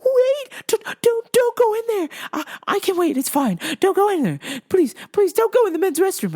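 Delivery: high, loud, flustered lady voice; mock panicked pleading. Older lady begging him off the men's restroom, mock panic. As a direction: plead urgently in a hammed-up high voice.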